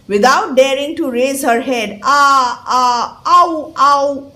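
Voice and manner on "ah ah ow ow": mock whining ah and ow; imitating wails. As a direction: wail like the flower girl. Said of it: "making such sounds".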